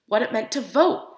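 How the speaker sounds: ordinary delivery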